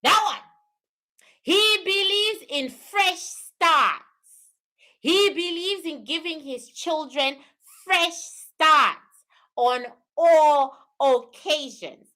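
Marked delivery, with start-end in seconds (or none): none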